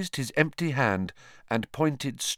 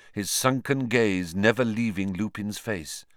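plain reading voice

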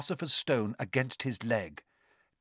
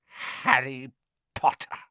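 eerie whisper. A narrator's reading. Face greeting Harry by name, menacing whisper. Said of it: "it whispered".

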